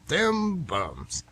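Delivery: "Dem bums" with a Brooklyn twang, d for th. Retold or imitated. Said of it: "they called them".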